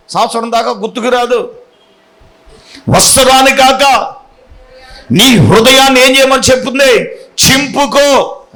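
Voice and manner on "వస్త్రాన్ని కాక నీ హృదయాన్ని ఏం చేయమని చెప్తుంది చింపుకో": louder and higher prophetic proclamation; forceful preaching of Joel's call to repentance; preaching at full force. Acted. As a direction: thunder the verse at them. Preacher quoting Joel forcefully.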